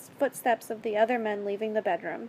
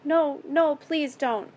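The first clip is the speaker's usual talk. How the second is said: raised, urgent plea; pleading; 5 semitones higher, 5 dB louder, slower than usual. A narrator's reading. Captive begs captor to stop, pleading. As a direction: plead with a higher, louder start.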